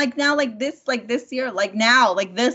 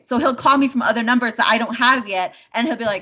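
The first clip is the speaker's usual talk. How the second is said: normal talking voice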